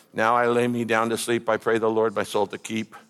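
low monotone bedtime prayer by rote; droning, memorized and mechanical; mechanical recitation. Acted. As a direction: rattle off a memorized prayer without thinking. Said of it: "mechanical, methodical, memorized prayer where you just go".

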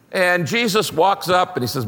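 usual speaking voice

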